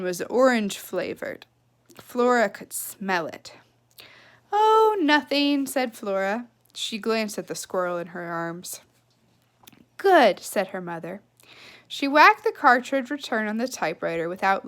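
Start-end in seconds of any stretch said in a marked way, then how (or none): 4.5-5.7 slow, higher, too-innocent. Acted. Flora hiding the squirrel. 10.0-10.4 louder, clipped-off distracted mother; curt mother.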